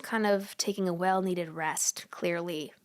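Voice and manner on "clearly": dry whispered clearly; whispered aside. Real, not acted. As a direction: murmur it as a wry aside. Whispered aside about resting.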